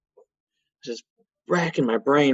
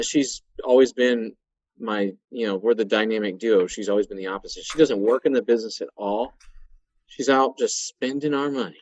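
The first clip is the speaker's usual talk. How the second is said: ordinary talk, nothing marked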